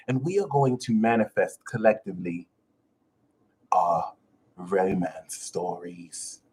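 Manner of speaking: normal talking voice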